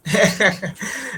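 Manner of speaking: a quick real chuckle; amused laugh. Real, not acted. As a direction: laugh off the question. Amused at "what is Swahili.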